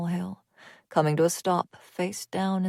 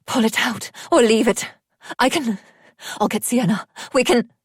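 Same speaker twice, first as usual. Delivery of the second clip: loud, higher panicked rush; desperate plea over a wounded friend; 5 semitones higher, 6 dB louder than usual. A narrator's reading. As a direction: scramble for a fix in panic.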